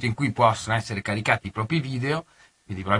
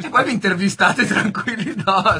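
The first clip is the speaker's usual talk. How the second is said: laughing; 7 semitones higher, 5 dB louder than usual. Real, not acted. Teasing joke to the guests.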